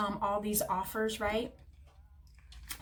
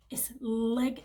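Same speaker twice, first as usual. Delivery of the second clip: normal talking voice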